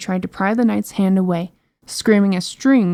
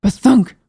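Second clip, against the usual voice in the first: booming thump; 3 semitones higher, 6 dB louder than usual. Acted. Footsteps sound effect.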